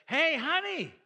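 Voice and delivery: calling loud and high up the stairs to his wife; husband hollering chores up to his wife, loud and high; mock yelling upstairs to wife. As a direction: shout the errand list up to the bedroom. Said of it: "I just yelled up to her like".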